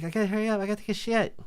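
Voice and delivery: urgent mock female voice, way up high; high-pitched girlfriend. Girlfriend bursting into the bathroom, urgent high voice.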